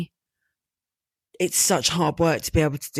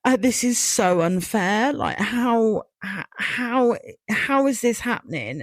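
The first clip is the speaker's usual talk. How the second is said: higher, strained voice near tears; real hurt with a catch on how; upset; 5 semitones higher, slower than usual. Real, not acted. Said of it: "God, sorry, I wasn't expecting this one to be this emotional".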